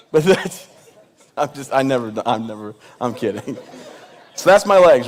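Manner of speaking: usual speaking voice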